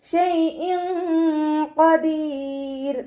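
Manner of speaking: slow, drawn-out melodic tajweed chant; Quran recitation. Shown as a demonstration. Teacher models melodic Quran recitation for students. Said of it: "നാവിനെ മുകളിൽ തട്ടിക്കാതെ ഓതുക".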